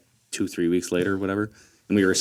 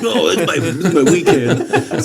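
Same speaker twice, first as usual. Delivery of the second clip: high, loud, drawn-out excuse through laughter; mock protest; 9 semitones higher, 7 dB louder, much slower than usual. Friend imitating the arm wrestling loser, protesting.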